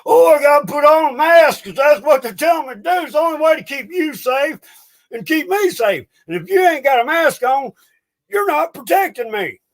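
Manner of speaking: hammed-up high mocking voice of the masked crowd; mocking sheeple voice. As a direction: squeal it like a brainwashed mask-wearer. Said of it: "They've tricked these people into".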